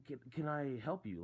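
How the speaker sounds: retold not-so-nice offer of help. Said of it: "Like, in a not nice way".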